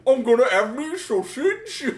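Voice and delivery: slow, loud hammed-up Cockney bloke; Big Earl Cockney. Voicing Big Earl for friends, loud slow Cockney. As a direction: play a brash Cockney lad. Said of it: "insult Big Earl like that".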